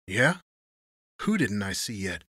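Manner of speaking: mild snarl, thickened; man edges toward a snarl at Tony. A narrator's reading. Thickened voice edging into a snarl. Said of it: "His thickened voice edged toward a snarl".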